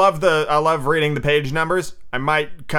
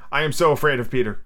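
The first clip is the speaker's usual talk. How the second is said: ordinary delivery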